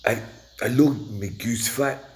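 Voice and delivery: slow, high, loud Scottish accent; attempted Scottish accent. Shown as a demonstration. [name] asked to try an accent, exaggerated Scottish accent. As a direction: put on a hammed-up Scottish brogue, drawn out. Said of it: "We try a Scottish accent".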